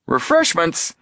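high, delighted exclamation; eager, high-pitched surprise; happy echo. A narrator's reading. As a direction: pipe up happily in a high voice. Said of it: "Chet echoed happily".

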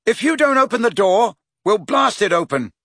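high, loud ultimatum; shouted threat. A narrator's reading. Harry warns he will blast the door, shouting. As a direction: call the threat through the door.

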